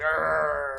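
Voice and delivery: long mock growling groan; imitating Kelly's reaction to the singing, drawn-out grumble; imitated grumble. Grumble it out in protest. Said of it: "Kelly would immediately just grumble".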